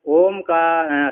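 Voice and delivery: drawn-out recitation. Acted. Reciting text word drawn out.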